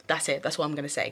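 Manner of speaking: ordinary delivery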